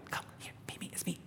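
whispered, tender invitation. Retold or imitated. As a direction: whisper it like calling a child back. God tenderly calling.

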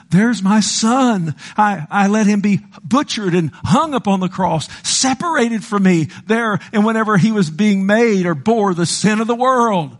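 high, emphatic preaching plea; impassioned as God. Retold or imitated. God telling sinners what his son suffered, impassioned high plea. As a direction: build up high and insistent, voicing God. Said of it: "God says".

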